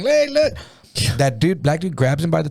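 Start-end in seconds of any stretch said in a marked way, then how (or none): 0.0-0.5 very high mocking call; amused onlooking guards. Guards at the window watching the slap, mocking. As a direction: imitate guards gawking in a high voice. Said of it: "guards are at the window laughing".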